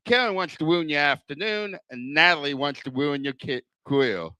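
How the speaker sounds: ordinary delivery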